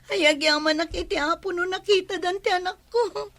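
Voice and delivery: grateful mother choking up; tearful thanks. Acted. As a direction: thank them through tears. Mother thanks for found child. (agsangsangit nga agyaman)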